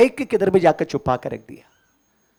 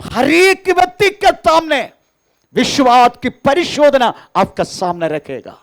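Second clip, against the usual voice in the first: higher and louder, hammering each phrase to the church; full-force preaching; 10 semitones higher, 6 dB louder than usual. Acted. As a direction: testify it out to the congregation, loud and high. (ऊँची और ज़ोरदार आवाज़ में प्रचार)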